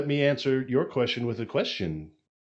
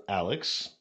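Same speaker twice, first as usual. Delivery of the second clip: ordinary talk, nothing marked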